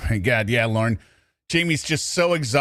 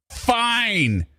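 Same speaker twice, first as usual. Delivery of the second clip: higher, clipped mock concession; mock grudging fine; 9 semitones higher than usual. Streamer mocking Lauren's grudging concession. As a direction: imitate his sulky give-in. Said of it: "and then he goes".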